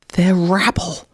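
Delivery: snarled "They're rabble" with scorn; contemptuous snarl. A narrator's reading. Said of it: "snarled the fox".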